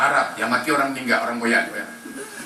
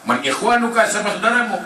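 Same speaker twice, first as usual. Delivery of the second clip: higher, commanding question; questioning angel; 7 semitones higher than usual. Acted. Angels questioning the dead in the grave, higher.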